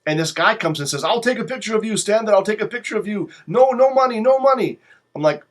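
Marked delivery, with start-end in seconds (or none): 1.0-4.8 higher, eager hustler voice pressing his offer; a touch of a high-pitched tout; imitating pushy photographer. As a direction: play the pushy photographer insisting. Sphinx photographer voiced by teller.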